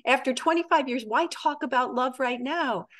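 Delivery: high-pitched, mounting list of chores; exasperated wife. Acted. Wife answers husband who asks about love, exasperated. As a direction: build the indignation, peaking high on the cow. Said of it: "And she says".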